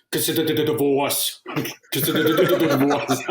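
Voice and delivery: lightly voiced Arnie one-liner, laughing through the second; a hint of Arnie's deadpan action-hero quip; Arnie impression. Imitating Arnie's line while recounting Total Recall. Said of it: "the classic Arnie thing".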